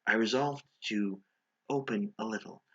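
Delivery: plain reading voice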